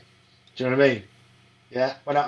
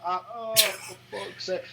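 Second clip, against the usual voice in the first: mock dismay; 7 semitones higher, slower than usual. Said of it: "I'm like".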